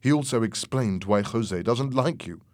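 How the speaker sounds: ordinary delivery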